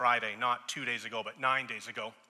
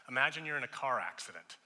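ordinary talk

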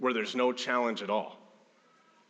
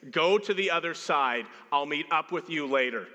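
higher-pitched insistent order; firm command; 5 semitones higher, 3 dB louder than usual. Acted. Jesus ordering disciples to the other side, firm command. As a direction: send them off, no more discussion. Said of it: "Only to have the suggestion turn".

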